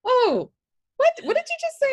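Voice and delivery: high, loud startled "what did you just say"; shocked squeal of disbelief; retold surprise. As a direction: jump up in pitch, caught off guard. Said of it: "That was the only time I was like".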